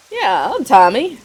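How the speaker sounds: village idiot Tommy. Acted. Tommy greeting the stranger, lightly voiced simpleton. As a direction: play him a bit slow and eager.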